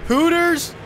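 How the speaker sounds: high, loud scoff, slow on the second line; mock outrage. Villain scoffing at a sponsor car, loud mock outrage. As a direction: sneer at the sponsor like a hammed-up villain.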